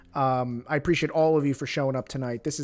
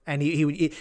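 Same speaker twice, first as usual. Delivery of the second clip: ordinary delivery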